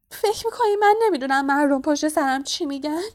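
high, loud aggrieved wailing; tearful outburst. A narrator's reading. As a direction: build to a loud, self-pitying wail. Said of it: "میرتل ادامه داد". (شکوهٔ بلند و گریان، با صدای زیر)

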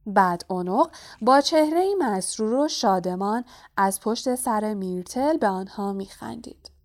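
usual speaking voice

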